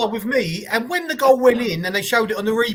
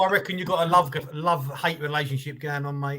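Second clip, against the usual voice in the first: ordinary delivery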